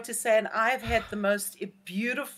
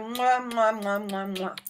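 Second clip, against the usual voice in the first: string of voiced goodbye kisses; blown kisses. Real, not acted. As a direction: blow a run of kisses goodbye. Blowing kisses at sign-off.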